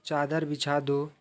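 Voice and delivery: calm English lesson dictation. Shown as a demonstration. Slow vocabulary drill sentences.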